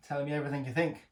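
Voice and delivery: usual speaking voice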